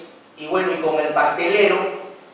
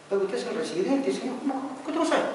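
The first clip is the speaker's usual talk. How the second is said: incredulous; 7 semitones higher, 5 dB quieter, faster than usual. Retold or imitated. Grilling the site resident.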